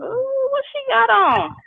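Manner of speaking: high, slow, drawn-out mock judgment; catty church girl. Imitating her younger self judging another woman's outfit, high slow catty. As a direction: sneer at an outfit like a gossip. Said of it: "I would be that girl who would sometimes say".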